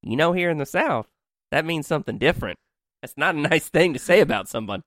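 high, sugary Southern lady imitation; sweet Southern voice. Southern coworker explaining what the phrase means, sweet Southern voice. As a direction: gently scold in a high Southern accent. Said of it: "sweet Southern voice".

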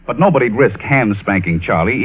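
ordinary talk

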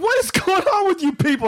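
high-pitched mock bewilderment; bewildered exclamation. Driver asking his screaming family, bewildered. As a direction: demand an explanation, voice jumping up.

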